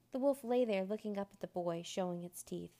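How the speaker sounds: ordinary delivery, nothing marked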